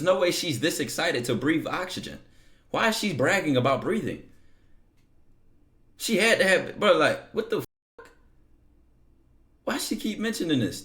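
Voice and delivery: high-pitched, baffled rant at the absurdity; exasperated disbelief. Real, not acted. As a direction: burst out incredulous, pitch jumping up. Baffled reaction to her messages.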